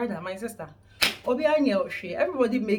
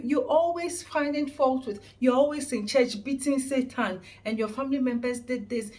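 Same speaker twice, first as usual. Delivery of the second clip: ordinary talk, nothing marked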